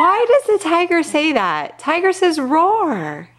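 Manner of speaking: high-pitched mock bafflement; playful disbelief. Real, not acted. Parent puzzled by toddler's tiger noise, playful disbelief.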